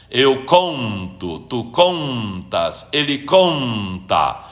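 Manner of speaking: slow, stretched CON in "conto", "contas", "conta"; stress on CON. Shown as a demonstration. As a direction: lean long on the first syllable of "conto", "contas", "conta". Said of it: "Onde está a sílaba tônica?". (tônica alongada no CON de "conto", "contas", "conta")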